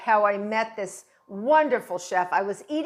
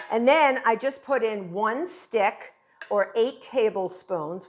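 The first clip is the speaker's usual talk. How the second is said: normal talking voice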